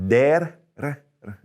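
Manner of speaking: "Der" then "r, r" tapped high; Spanish flapped R. Shown as a demonstration. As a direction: tap the R lightly, teacher showing the flap. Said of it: "There's that flap again".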